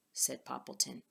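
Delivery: ordinary delivery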